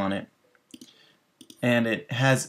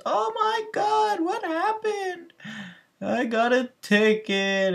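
much higher, slow mock crying wail; imitating sobbing; slower than usual. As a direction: wail it like a little kid in tears. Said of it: "boom, tears".

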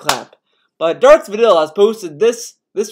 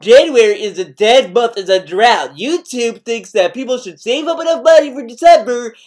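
loud whiny streamer rant, higher; ranting streamer impression; 4 semitones higher, 6 dB louder than usual. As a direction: play a streamer begging for tips and ranting. Mocking streamer begging for tips.

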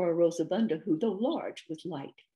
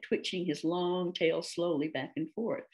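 ordinary delivery

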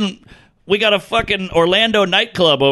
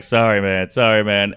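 sheepish low sorry; lower apologetic mutter; 7 semitones lower than usual. Retold or imitated. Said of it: "He's like".

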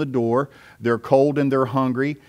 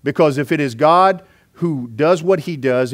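normal talking voice

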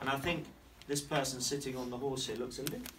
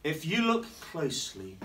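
ordinary delivery

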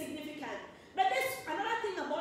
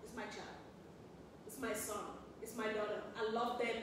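loving mama; 5 semitones lower, 7 dB quieter than usual. Retold or imitated. Mama defending her child when everybody else gives up, soft and low. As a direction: lower and much softer, a mother's tender claim. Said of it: "Your mama is gonna still say".

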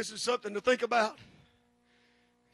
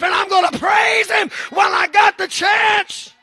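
loud, higher, rising preacher's shout; preaching at full force; 6 semitones higher, 11 dB louder than usual. Acted. Pastor exhorting his congregation to praise, full-force preaching. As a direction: shout the blessing home to the church.

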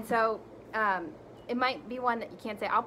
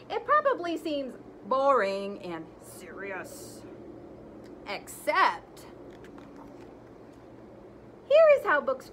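lively, animated read-aloud; reading to children; 7 semitones higher, 6 dB louder than usual. Acted. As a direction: read it big for the kids. Teacher reads the book aloud expressively.

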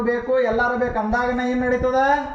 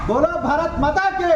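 rallying call; 6 semitones higher, 3 dB louder than usual. Real, not acted. Patriotic call and response.

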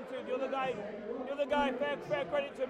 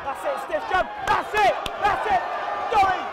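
very loud, high cheering; excited shouting; 6 semitones higher, 11 dB louder than usual. Real, not acted. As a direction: yell with pure excitement. Cheering a good exchange.

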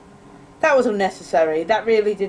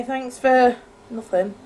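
usual speaking voice

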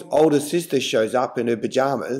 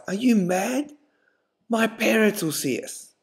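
higher, shocked protest; horrified girlfriend; 8 semitones higher than usual. A narrator's reading. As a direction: gasp at the very idea. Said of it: "Horrified, she replies".

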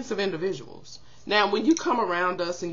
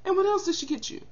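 friend's scornful question; 11 semitones higher, faster than usual. Retold or imitated. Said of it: "she looked at me like".

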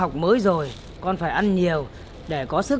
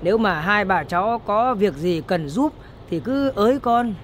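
usual speaking voice

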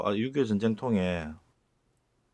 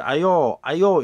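high, loud friendly calling out; 친근하게 크게 부르는 사투리; friendly dialect call; 6 semitones higher, 8 dB louder, much slower than usual. Retold or imitated. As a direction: hail someone across the street. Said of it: "친근하게 부를 때".

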